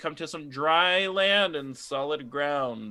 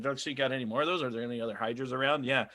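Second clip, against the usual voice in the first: fast eager dogs; much faster than usual. Acted. Dogs voiced asking for more.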